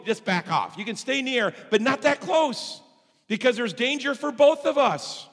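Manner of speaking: emphatic, high-pitched warning to a pet; urgent pleading with dog. Retold or imitated. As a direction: coax a dog that doesn't understand, pitch climbing. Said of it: "I try to tell Chloe".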